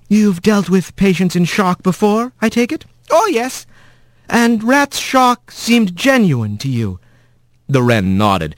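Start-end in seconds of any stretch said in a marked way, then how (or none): 0.1-7.0 higher earnest questioning; gentle careful attorney; young mouse lawyer. A narrator's reading.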